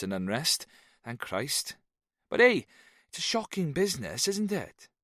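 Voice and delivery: high, lilting Welsh rant; a tipsy Welshman grumbling; lilting Welsh accent. A narrator's reading. Jones grumbles about politics in a lilting Welsh accent. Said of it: "Jones's diction was lilting and slow".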